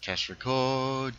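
slow put-on announcer read; unusual recording voice. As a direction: say it in a fake recording voice. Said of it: "you didn't use your regular recording voice".

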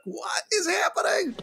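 very high-pitched incredulous squeal; mock bewildered cry. Retelling an agent confronted by his client dressed as a woman, mock bewildered squeal. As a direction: squeal it in hammed-up disbelief. Retells George's total bafflement.

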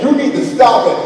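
loud stern command. Retold or imitated. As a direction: lay down the law. Dad in her face.

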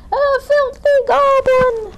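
very high, loud, drawn-out shriek; farmer's shriek. A narrator's reading. Farmer spots Goblin on the road, horrified shriek. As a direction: shriek it slowly in horrified disgust. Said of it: "shrieked the farmer".

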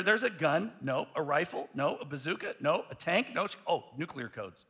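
normal talking voice

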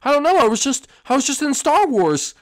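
put-on a scared little boy shouting in a strange place; panicked high kid voice.

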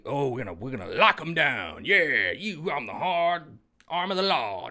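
a hint of a swaggering cop; mock tough talk. Mocking people who say lockdown, lightly voiced tough talk. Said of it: "when you say".

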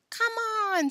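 high, loud cheering-up; rally a slumped friend; hearty encouragement. A narrator's reading. Joe slapping Alan on the shoulder, hearty encouragement.

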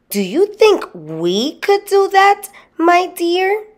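high, squeaky wife asking her husband; Mrs Gregg's voice. A narrator's reading. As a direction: pitch it way up, a politely wondering little wife. Wife asks husband in story.

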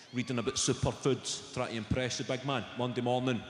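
ordinary delivery

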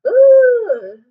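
very high, loud grossed-out ooh; disgusted ooh. Real, not acted. Teacher reacting to a gross picture for kids.

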